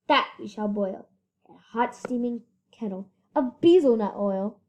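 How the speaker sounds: ordinary delivery, nothing marked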